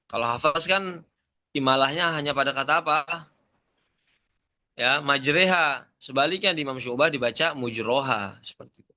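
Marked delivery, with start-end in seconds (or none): none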